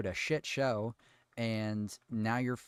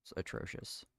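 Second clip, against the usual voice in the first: disgusted whisper; 3 semitones lower, 9 dB quieter than usual. Real, not acted. Disgusted rant verdict.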